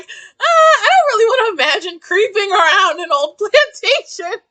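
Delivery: high laughing protest. Real, not acted. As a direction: laugh through it loudly. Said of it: "if I'm like".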